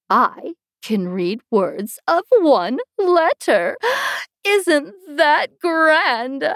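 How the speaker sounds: high, slow, wondering queen voice; a proud, fussy old queen; high, slow doting queen; dotty queen. Acted.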